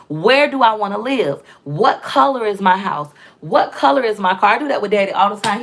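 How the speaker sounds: normal talking voice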